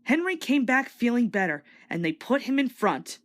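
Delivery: ordinary delivery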